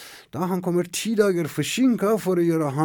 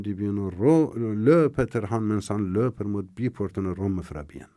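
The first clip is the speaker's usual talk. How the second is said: ordinary delivery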